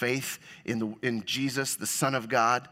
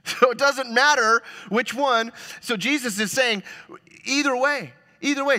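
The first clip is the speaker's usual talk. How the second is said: emphatic rise; 10 semitones higher, 5 dB louder than usual. Real, not acted. Preacher building emphasis.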